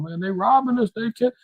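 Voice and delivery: high-pitched mock whining; mock crying. Crypto heads complaining online they got robbed, mock crying. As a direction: whine it out, mocking the complainers. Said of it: "crying online, talking about".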